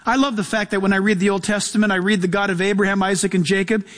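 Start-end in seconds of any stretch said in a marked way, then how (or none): none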